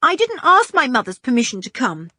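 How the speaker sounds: bravado. A narrator's reading. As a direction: brag a little, pleased with himself. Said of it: "with a great air of bravado".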